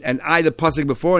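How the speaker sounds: ordinary delivery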